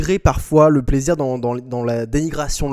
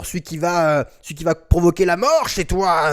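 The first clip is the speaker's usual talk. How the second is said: ordinary talk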